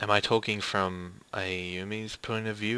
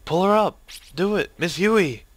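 high, loud rescue orders; frantic shouting; 7 semitones higher, 6 dB louder than usual. Acted. Character orders others to pull teacher up, frantic shouting. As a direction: shout short, desperate commands.

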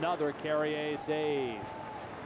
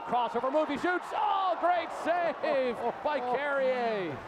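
excited save call; 7 semitones higher, slower than usual. Real, not acted.

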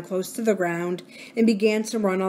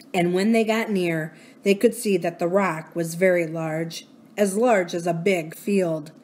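ordinary delivery

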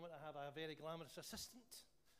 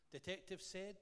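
ordinary talk, nothing marked

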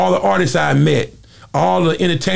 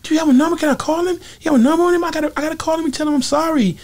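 [name] voice; faster than usual. Retold or imitated. Mike frets to [name] about [name], in a high mock voice. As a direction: imitate Mike's high fretful voice, pitched way up.

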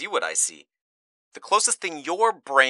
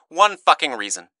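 louder, higher, pressing; desperate demand; 5 semitones higher, 7 dB louder than usual. Acted. Speaker begging Mitch for a reason, desperate. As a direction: demand it, cracking open.

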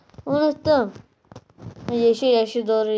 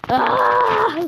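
loud high aah of sudden discovery; sharp surprised cry; startled shout; 11 semitones higher, 8 dB louder than usual. Real, not acted. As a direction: yelp as it clicks. Sudden realisation in game.

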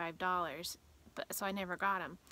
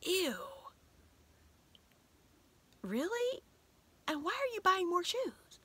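mocking husband Daryl; 11 semitones higher than usual. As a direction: play the husband who pulls a face at the new shoes. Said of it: "he'll be like".

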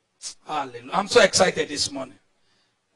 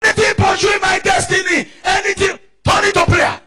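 high, loud imitation of frantic prayer; hammed-up congregational prayer shout; mock shouting; 12 semitones higher, 7 dB louder than usual. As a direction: mimic clueless believers shouting their prayers. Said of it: "they shout".

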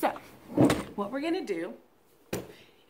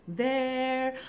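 ordinary delivery

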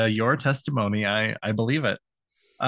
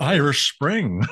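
slow, drawn-out soap commercial voice; mock ad read; 3 semitones higher, slower than usual. Joking about Bill's first shower, a slow mock ad. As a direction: sell it like a TV announcer.

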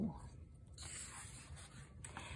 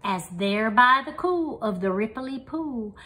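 ordinary delivery

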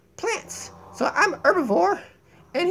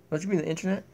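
deep minion voice; 13 semitones lower, 9 dB quieter, faster than usual. Pretend.

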